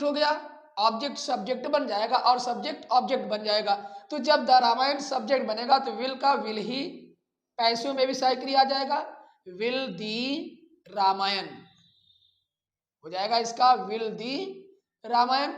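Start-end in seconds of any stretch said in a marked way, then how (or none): none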